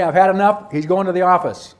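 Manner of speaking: a teacher at the end of her rope; fed-up teacher. Retold or imitated. Teacher imitating a fed-up colleague, loud exasperated. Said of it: "when do teachers say".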